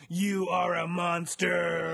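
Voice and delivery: slow ominous proclamation; dramatic reading. Acted. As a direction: boom it out like a horror-book reveal. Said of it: "this is a quote from the book".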